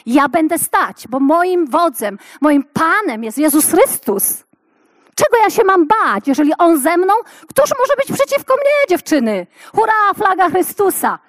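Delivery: rally the room like a battle cry; rousing preaching. Acted. Preacher rallying the women, triumphant and loud.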